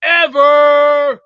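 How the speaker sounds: a long loud scornful "Whatever" stretched slow; drawn-out dismissive whatever. Real, not acted. Streamer brushing off a troll comment, loud and scornful.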